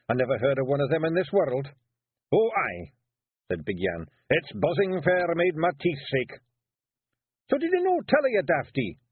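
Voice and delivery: gruff little Scots warrior caught out; Feegle Rob. Acted. Rob defending himself to the lads, Scots pixie voice.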